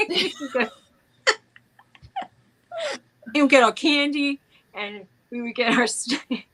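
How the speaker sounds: laughing through a Bronx New York accent. Shown as a demonstration. New York accent, laughing about childhood candy runs. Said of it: "so New York".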